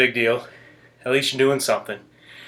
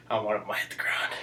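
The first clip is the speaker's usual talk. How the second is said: whispering; 4 semitones lower, 11 dB quieter, faster than usual. Real, not acted. Coaching aside to viewers.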